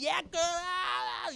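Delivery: put-on high, loud screech at a girlfriend; mock screaming. Ladies bumping against people in line, screaming. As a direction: imitate an obnoxious screamer, stretched out. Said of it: "they're not only screaming".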